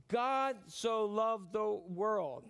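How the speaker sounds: God so loved the world drawn out slowly, each word weighted; slow emphatic reading. Shown as a demonstration. Preacher slowly reading the verse to the congregation, deliberate. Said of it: "preacher stresses verse".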